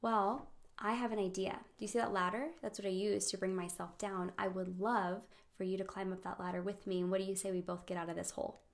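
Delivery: soft, reassuring offer; quiet, tender invitation; gentle empathy. Acted. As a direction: coax a friend softly, as if sitting beside them. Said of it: "Empathy, however".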